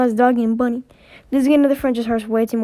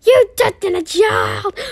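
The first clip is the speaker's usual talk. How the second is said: high, slow outraged cry with a gasp; scandalised shriek at a touch; shocked yell, high and drawn out; outraged shout; 8 semitones higher, 6 dB louder, much slower than usual. Acted.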